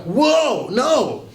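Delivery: startled high yelp of alarm. Retold or imitated. Pastor retelling waking beside church lady, startled. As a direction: jolt awake shouting whoa.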